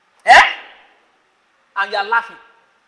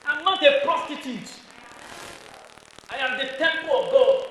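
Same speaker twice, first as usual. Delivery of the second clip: stern warning; 4 semitones higher than usual. Shown as a demonstration. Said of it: "Warn the person".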